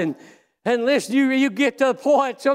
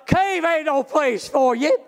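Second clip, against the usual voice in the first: raised, shouting preacher cadence; preaching at full force; 5 semitones higher than usual. Acted. Preacher rousing his church, shouting with fervor. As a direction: preach it hard to the congregation. Preacher voicing God's call.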